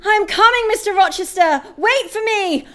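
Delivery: loud, high call into the distance. Acted. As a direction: call out to someone far away. Calling out to distant Rochester.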